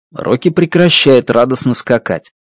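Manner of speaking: ordinary delivery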